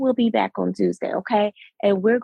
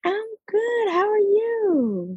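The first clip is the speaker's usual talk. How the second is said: high cheerful greeting, drawn out; bright greeting; 12 semitones higher, slower than usual. Real, not acted. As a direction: greet your friend sweetly.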